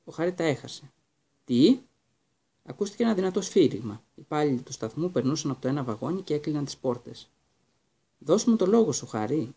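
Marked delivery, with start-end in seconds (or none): 1.4-1.8 sudden loud what; startled. Acted. Boy stunned by the father's request, sudden and loud. 8.2-9.5 hurried urging. Acted. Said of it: "ακόμα πιο βιαστικά".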